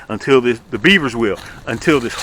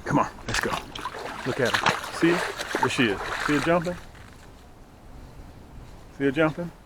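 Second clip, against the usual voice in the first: soft, hushed voice so the deer stays calm; hushed fishing-trip voice; 12 dB quieter than usual. Real, not acted. Quietly watching a deer nearby.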